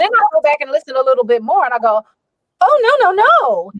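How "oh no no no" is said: sudden high dismayed cry of no; mock horror at her own take; alarmed no no. Hears her mistake on playback.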